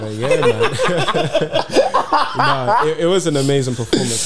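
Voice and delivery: high, loud laughing talk; cracking up mid-sentence. Real, not acted. Laughing about joke.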